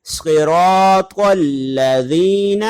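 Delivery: heavy ص and ط in a drawn-out recitation; slow tajweed recitation; Quranic recitation. Shown as a demonstration. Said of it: "قرآن کریم میں اس کی مثالیں".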